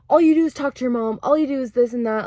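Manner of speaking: mock angry complaint. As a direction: rattle off the grievances.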